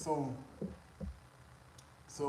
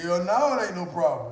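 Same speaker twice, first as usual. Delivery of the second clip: much louder, higher, emphatic; confident shout; 6 semitones higher, 15 dB louder than usual. Retold or imitated. Shouting confidently to the woman about her charger. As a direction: belt it out with swagger. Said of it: "That's how I said it".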